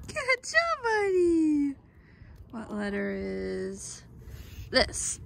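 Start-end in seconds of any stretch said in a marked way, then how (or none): 0.1-1.7 high sing-song good job. Real, not acted. Praising child.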